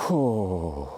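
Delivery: long breath blown out; real 'whew' to steady emotion; emotional exhale. Real, not acted. Speaker getting emotional on camera, heavy exhale. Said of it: "I'm getting all emotional now".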